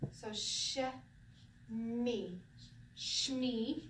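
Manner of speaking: "sh", "me", then blended into "shme"; syllables smushed together. Shown as a demonstration. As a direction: build "shme" slowly from its two parts. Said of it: "kind of smush it together".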